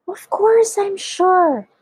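high, drawn-out, emphatic outburst; excited old man, loud and insistent; excited splutter. A narrator's reading. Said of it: "spluttered the old man excitedly".

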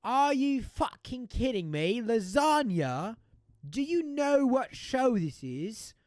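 loud, slow, high incredulous chef; mock an outraged yell, stretching each word; Gordon Ramsay. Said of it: "sorry for yelling".

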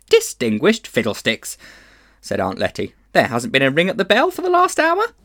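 put-on brisk, dismissive old lady; scornful aunt. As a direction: scoff at his nonsense. Aunt Letty dismissing him.

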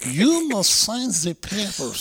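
high, slow mock German accent. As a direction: drag it out like a hammy German interrogator. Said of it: "you're not gonna do a German accent".

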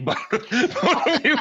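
high, laughing through the words; real laughter breaking up the words. Real, not acted. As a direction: crack up while starting the sentence. Breaks up laughing at own aside.

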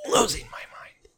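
eerie high whisper; unvoiced high whisper of madness. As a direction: hiss it out as if losing your mind.

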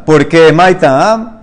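ordinary talk

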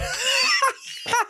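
high, squealing laugh; helpless laughter. Real, not acted. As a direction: collapse into giggles. Cracks up after the bam.

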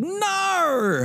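high Australian wail of no; mock Australian accent. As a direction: cry it out in broad fake Australian. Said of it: "He's Australian, so he's just like".

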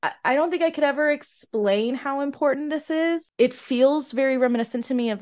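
choked up and fighting to hold it together; emotional. Real, not acted. A fan describing the coronation scene, overcome and emotional. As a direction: voice lifting high, on the edge of tears. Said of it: "makes me very emotional".

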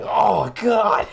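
loud high yelp; shouted oh God. Retold or imitated. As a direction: yell it out in shock. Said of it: "he's like".

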